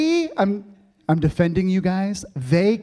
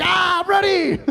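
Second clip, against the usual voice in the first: much higher, bursting with eagerness; excited shout; 12 semitones higher than usual. Retold or imitated. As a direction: blurt it out, fired up and ready. Pumped after inspiring talk.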